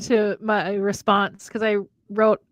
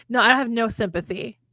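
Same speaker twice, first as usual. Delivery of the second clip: ordinary talk, nothing marked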